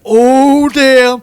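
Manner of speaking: high, loud mock-impressed "oh"; crowd's burn reaction. Everyone reacting to Romanov's put-down, high loud mock amazement. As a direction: hoot like onlookers hearing a great put-down.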